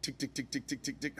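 quiet, rapid mechanical ticks; small clicking engine imitation; imitated failed-ignition ticking. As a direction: tick evenly like a dead starter. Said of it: "It goes tick".

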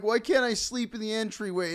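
high, loud retold complaint; imitated protest. Man in a tarp to store staff, loud protest. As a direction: protest loudly as the man being turned away.

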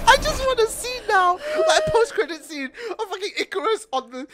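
high, giggle-choked speech; laughing. Real, not acted. Pitching a post-credit scene to co-hosts, cracking up laughing. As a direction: talk through helpless laughter.

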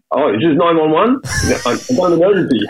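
high, laughing mock distress call; joking fake emergency. Prank-calling Michelle from the ocean, laughing mock emergency. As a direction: ham up a fake 911 call through laughter. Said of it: "I said".

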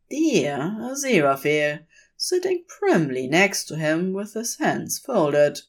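plain reading voice

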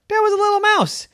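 high squeak announcing a mouse; high squeaky. A narrator's reading. Mouse appears.

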